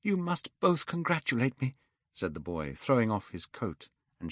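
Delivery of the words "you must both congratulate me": put-on young Dorian, elated. Dorian's voice in the story.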